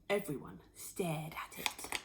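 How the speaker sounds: whisper. A narrator's reading. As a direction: hush it in awe. Awed silence at the hen.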